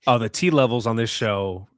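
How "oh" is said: usual speaking voice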